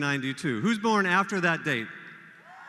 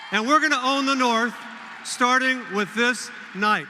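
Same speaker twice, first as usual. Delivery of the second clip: higher and louder, rousing the room; rallying call; 5 semitones higher, 4 dB louder than usual. Real, not acted. Speaker to the crowd, rousing rally cry.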